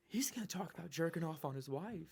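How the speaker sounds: whispery comedian's aside; soft, breath-only mock commentary; Jim Gaffigan. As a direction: whisper it like a scandalised audience member. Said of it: "his voice. I was trying to do it".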